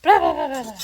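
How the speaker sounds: husband yelling at Alexa, hammed-up frustration; mock frustrated yell. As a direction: blurt the gibberish at the robot in exasperation. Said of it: "He gets frustrated. He's like".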